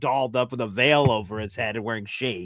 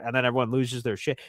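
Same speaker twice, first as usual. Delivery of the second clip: ordinary talk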